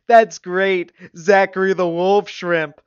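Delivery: loud, high, gleeful mockery; guffawing. A narrator's reading. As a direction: whoop it as if cracking up. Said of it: "Dane guffawed".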